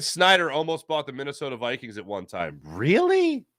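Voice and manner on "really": high, quick surprised question. Real, not acted. Host reacts to a listener's surprising fact, surprised. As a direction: genuinely caught off guard.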